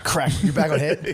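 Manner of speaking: laughing through the words. Real, not acted. Friends laughing over a running joke, laughing. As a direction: chuckle while saying it.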